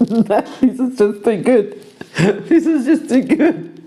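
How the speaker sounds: high laughing through the words; can't stop laughing; laughing hard. Real, not acted. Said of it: "GM laughing at the roll".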